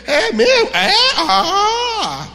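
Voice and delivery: high squeaky startled gasp; hammed-up shocked believer; mock shock. Pastor voicing a startled member, hammed-up shock.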